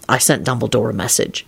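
low, toneless reply; flat. A narrator's reading. Lupin answering Elizabeth at parting, flatly. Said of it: "he replied flatly".

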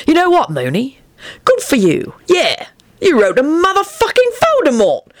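high, admiring congratulation; impressed. A narrator's reading. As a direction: sound thoroughly impressed, pitch rising. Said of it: "he reiterated in an impressed voice".

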